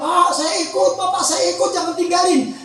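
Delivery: imitated pleading of a bereaved wife; mock weeping. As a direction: plead like a widow, a touch of mock wailing. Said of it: "terus dia nangis". (pura-pura menangis memohon ikut)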